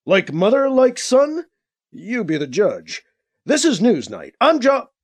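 solemn news sign-off; intoning newsreader. A narrator's reading. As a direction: close the segment like a grave anchor. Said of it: "the announcer intoned".

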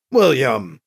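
mildly angry warning, dropping on the name. A narrator's reading. Anita cuts William off by name, authentically angry but contained. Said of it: "Anita sounded authentically angry now".

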